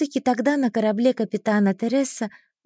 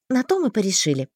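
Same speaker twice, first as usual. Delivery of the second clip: plain reading voice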